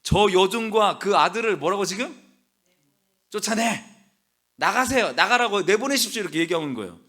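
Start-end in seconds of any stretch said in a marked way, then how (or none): 3.3-6.2 loud, higher-pitched order to get out; acted demand. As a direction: throw them out, as an indignant wife. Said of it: "사라가 아브라함에게 말하였다". (내쫓으라고 다그치는 말투)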